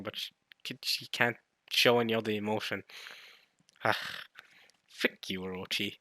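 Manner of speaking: ordinary talk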